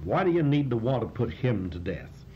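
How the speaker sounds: normal talking voice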